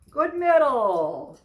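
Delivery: slow, high, loud praise; lavish praise for the dog; drawn-out praise. Real, not acted.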